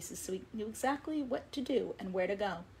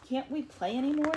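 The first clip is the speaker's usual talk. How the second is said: higher, louder plaintive plea; childlike disappointment, voiced high; sad little fish; 6 semitones higher, 6 dB louder than usual. A narrator's reading. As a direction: plead sadly, pitched up. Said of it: "said Little Blue sadly".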